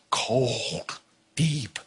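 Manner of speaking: low, soft single words; hushed emphasis. Real, not acted. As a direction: drop low and let each word sink in. Dwells on the frightening deep water.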